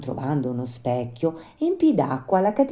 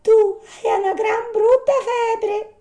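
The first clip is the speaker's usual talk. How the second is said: very high, slow animal voice; grave little marmot; 8 dB louder, slower than usual. Acted. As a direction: give a slow diagnosis in a tiny high voice.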